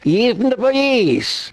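high, drawn-out mock outcry; mock outrage. Rabbi retelling how police were called on him, mock outrage. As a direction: stretch it out high in mock disbelief. Indignantly mocking a call to police.

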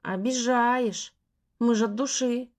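slow, drawn-out coaxing. Acted. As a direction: drawl it out, wheedling. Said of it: "протянул один из парней".

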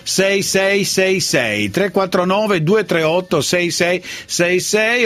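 slow, digit-by-digit number reading; slow dictation. Acted. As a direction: read each digit slowly and clearly. Reads the phone number out.

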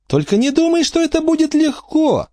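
very high-pitched character voice; warning. A narrator's reading. Angelica warning Dogface, high-pitched warning. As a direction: warn in a high, airy voice. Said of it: "предупредила его Анжелика".

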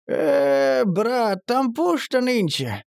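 high, slow drawl; drawn out. A narrator's reading. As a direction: stretch the words out. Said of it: "протянул Лёшка".

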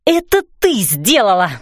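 furious accusing yell. A narrator's reading. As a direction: scream the accusation. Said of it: "вопила я".

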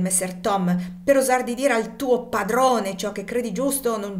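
loud, high enraged roar; furious outburst. Acted. Master raging at Tom for refusing to whip the woman, furious shout. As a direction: explode at the slave who dares defy him. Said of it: "indi proruppe".

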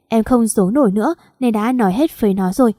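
ordinary delivery

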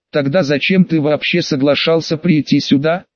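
usual speaking voice

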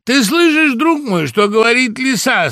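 high, loud call to a friend. A narrator's reading. Woodpecker asking rooster whether he hears fox, calling out. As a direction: hail your friend insistently.

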